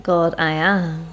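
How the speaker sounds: usual speaking voice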